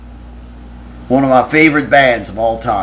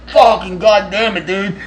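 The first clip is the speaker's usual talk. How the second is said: higher-pitched irritated cry; pained outburst; 7 semitones higher than usual. Retold or imitated. Teller just punched in the nose, mock angry outburst. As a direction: curse like you just got hit. Retelling reaction to being hit.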